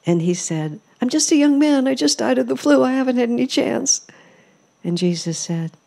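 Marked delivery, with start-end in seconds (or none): 1.0-4.1 high, loud desperate protest; a young man begging, voice jumping up high; pleading young man. Retold or imitated. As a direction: plead your case like a young man cut down too soon. Dead young man pleads with Jesus.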